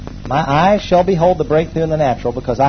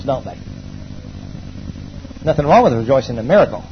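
ordinary talk, nothing marked

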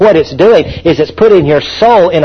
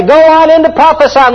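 fiery exhortation; 10 semitones higher than usual. Acted. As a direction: urge them onward. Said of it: "Don't just stop".

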